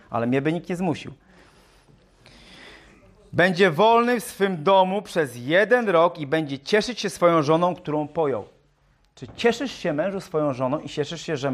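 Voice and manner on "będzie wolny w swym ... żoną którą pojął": scripture reading. Acted. Preacher rereads the newlywed verse to husbands, scripture reading. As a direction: proclaim the verse with emphasis on every phrase. Reads scripture aloud to congregation.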